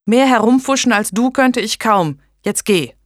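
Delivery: high, loud rebuke easing into an order; angry retort. A narrator's reading. Blanche sending the bungling midwife away, angry. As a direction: snap back, then dismiss.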